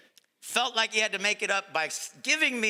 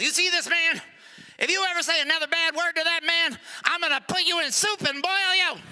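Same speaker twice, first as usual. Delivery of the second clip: threatening manager; 9 semitones higher than usual. Acted. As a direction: threaten the bird with real menace.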